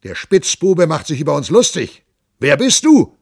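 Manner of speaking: loud, high, furious demand; captain roars, who are you; angry shout. Acted. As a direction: bellow at the rascal. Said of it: "schnauzte Kuttler".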